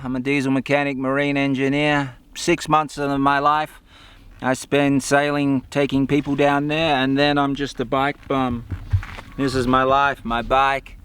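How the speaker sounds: ordinary delivery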